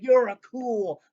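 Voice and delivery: a loud, drawn-out Woody line; Woody impression. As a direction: gush like an impressed toy sheriff. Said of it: "when Woody looks at Buzz Lightyear".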